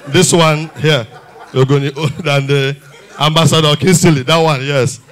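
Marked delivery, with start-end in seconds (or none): none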